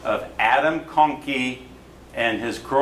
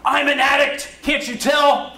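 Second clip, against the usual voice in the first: high, loud indignant yell; retold yell; 5 dB louder than usual. Young woman on the street yelling at the photographer, high angry yell. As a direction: yell it out in outrage, pitched way up. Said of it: "she yells at me".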